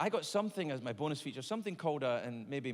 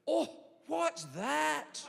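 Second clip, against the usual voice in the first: surprised; 11 semitones higher, much slower than usual. Retold or imitated. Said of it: "She went".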